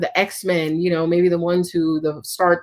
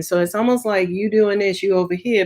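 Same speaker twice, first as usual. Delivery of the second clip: normal talking voice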